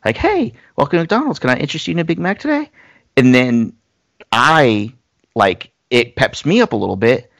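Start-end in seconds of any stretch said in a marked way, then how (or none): 0.2-2.7 put-on much higher, quick, bubbly drive-thru girl; upbeat bubbly greeter. Retelling the McDonald's recording, bubbly and much higher. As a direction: chirp it like a peppy greeter. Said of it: "upbeat, bubbly".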